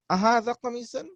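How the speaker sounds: أهذا قميص with a high rising tune; high rising question tune. Shown as a demonstration. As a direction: start أهذا high and rise. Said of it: "we say, for instance".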